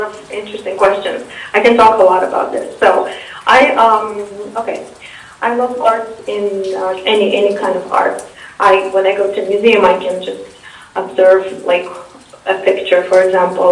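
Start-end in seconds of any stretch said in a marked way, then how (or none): none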